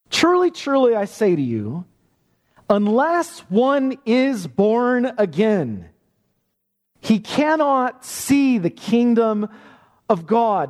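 high opening truly, then slow deliberate words; weighty preacher's proclamation; solemn emphasis. Acted. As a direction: proclaim it slowly like a solemn decree. Preacher voicing Jesus' solemn words.

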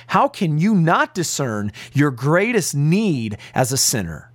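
ordinary delivery

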